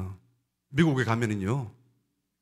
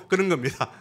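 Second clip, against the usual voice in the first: ordinary talk, nothing marked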